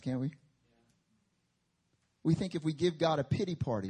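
usual speaking voice

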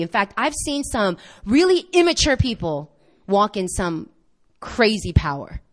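ordinary talk, nothing marked

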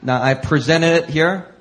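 ordinary talk, nothing marked

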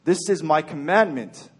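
usual speaking voice

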